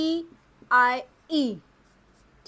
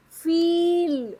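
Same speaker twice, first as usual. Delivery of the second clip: फील said slowly, higher and louder, the long ई held; drawn-out demo word; 4 semitones higher, 5 dB louder, much slower than usual. Shown as a demonstration. Teacher to pupils, holding the long ee in फील. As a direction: hold the ee in फील like a model word. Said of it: "F ई ई L".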